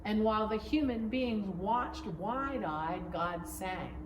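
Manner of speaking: plain reading voice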